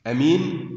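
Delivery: أمين said with a short opening a; short first vowel. Shown as a demonstration. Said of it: "فعيل من الأمانة". (أمين بلا مد في الهمزة)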